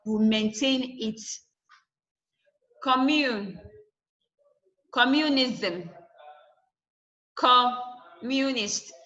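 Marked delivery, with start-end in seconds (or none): 2.8-3.6 Commune with COM stressed. Shown as a demonstration. Teacher shows the base word, Commune stressed on COM. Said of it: "your com that is stress". 4.9-8.9 communism, communist with COM stressed. Shown as a demonstration. Teacher shows suffixes keep the stress, COM stressed in communism, communist. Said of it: "your com that is stress".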